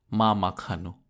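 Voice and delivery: ordinary delivery, nothing marked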